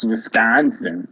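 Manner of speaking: put-on slow, drawn-out Wisconsin-accented "Wisconsin" with a falling end. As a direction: stretch out "Wisconsin" in a Midwest Wisconsin accent. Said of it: "you've got a very good Wisconsin accent".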